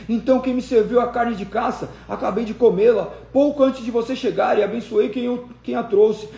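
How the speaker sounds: put-on a blind old father realizing he was tricked; trembling in shock. A narrator's reading. Isaac asks Esau who brought the meat, shaken and high. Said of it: "Isaque começou a tremer incontrolavelmente".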